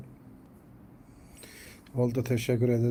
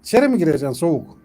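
loud, higher calls to the cat; calling loudly; 5 semitones higher, 9 dB louder than usual. Real, not acted. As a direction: call the stubborn cat over, loud.

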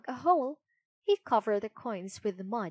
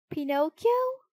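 cooing; 7 semitones higher, 6 dB louder than usual. Acted. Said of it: "cooed the pigeon".

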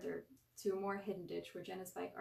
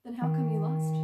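much louder, needling jab; prodding jab; 3 semitones lower, 16 dB louder than usual. A narrator's reading. Derek prodding his brother about losing, louder and needling. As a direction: poke at his brother's pride. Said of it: "Derek prodded".

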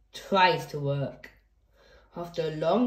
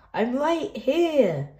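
higher, slow, indignant dad; mock protest; 6 semitones higher, slower than usual. Reader as Dad to the pretending kids, slow mock protest. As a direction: stretch it out as the ignored dad.

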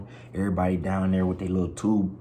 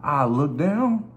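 higher, slow mock horror; retold dread; 8 semitones higher, 3 dB louder, much slower than usual. Telling friends about looking down mid-jump, slow mock horror. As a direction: drag it out as the drop hits you. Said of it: "I said".

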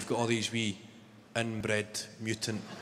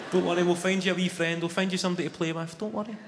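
lightly voiced mum, higher pitch; reassuring mum; 7 semitones higher, faster than usual. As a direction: reassure a bored little kid in a higher voice. Mum soothing bored child, acted.

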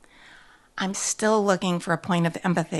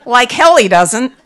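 loud, higher angry comeback; indignant retort; 5 semitones higher, 12 dB louder than usual. A narrator's reading. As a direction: throw it back at full volume. Narrator's indignant retort to owner.

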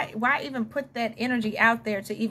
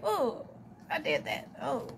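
soft, mortified groaning; cringing; 8 dB quieter, slower than usual. Acted. Little memories pop up, cringing at oneself. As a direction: wince quietly as the memory pops up. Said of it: "look back and get cringe".

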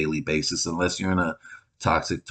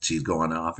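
normal talking voice

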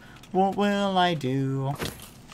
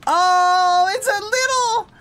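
gushing squeal; 7 dB louder than usual. Real, not acted. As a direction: melt over the tiny bee. Finds baby bumblebee.

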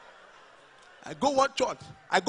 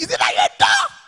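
very high, loud teasing shout; hammed-up mom impression; mock mother shout; 8 dB louder than usual. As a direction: throw her line back at her. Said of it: "Mo ní".

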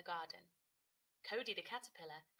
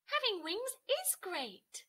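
higher, louder, slow delight; proud new butterfly enjoying her wings; 10 semitones higher, 6 dB louder, slower than usual. Acted. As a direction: savour it joyfully. Happy butterfly Cody.

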